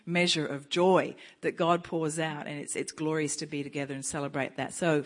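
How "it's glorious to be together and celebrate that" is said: normal talking voice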